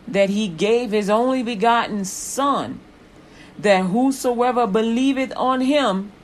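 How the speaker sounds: loud, rising sermon voice; preaching at full force. Acted. Preacher proclaiming John three sixteen to the congregation, loud and building.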